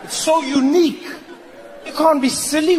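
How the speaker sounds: ordinary talk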